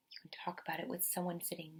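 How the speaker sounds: soft unvoiced whisper to the class; whispered aside. Real, not acted. Teacher whispering to the kids, hushed. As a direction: lean in and whisper the suggestion.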